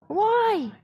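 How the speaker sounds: high plaintive why; a lamenting high why. Retold or imitated. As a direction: cry out why to the sky. Voicing the human cry why.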